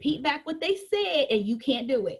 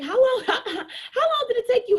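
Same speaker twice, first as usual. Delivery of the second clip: laughing question; 9 semitones higher, 4 dB louder than usual. Retold or imitated. Client asks while laughing.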